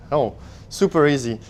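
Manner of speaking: ordinary talk, nothing marked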